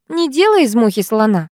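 plain reading voice

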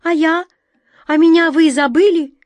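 loud, high, hurt appeal; high-pitched pleading yell; desperate cry. A narrator's reading. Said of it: "отчаянно закричала белка".

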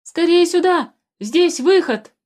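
high, loud excited shout. A narrator's reading. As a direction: call out the good news at full voice. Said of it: "закричал".

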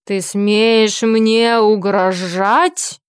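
slow, menacing orc growl; guttural rough orc roar. Acted. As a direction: growl it slowly like a threatening orc. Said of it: "рычал орк".

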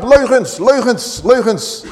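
mock crowd yelling leugens; crowd shouting lies. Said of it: "En dan roepen ze luid".

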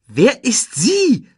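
high, loud, slow demand; demanding question. A narrator's reading. Timon wants to know who she is, high and demanding. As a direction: demand to know, stretching each word. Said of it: "wollte Timon wissen".

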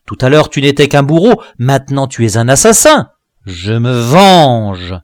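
loud, fast spite, slowing on the last words; the dwarf's vengeful outburst, loud; vengeful snarl. Acted. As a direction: spit it out through gritted teeth. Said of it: "répondit-il en grinçant des dents".

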